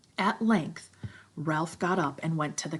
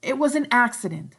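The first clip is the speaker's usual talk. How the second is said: higher, louder blurted claim; sudden Piggy; 8 semitones higher, 9 dB louder than usual. Acted. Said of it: "said Piggy suddenly".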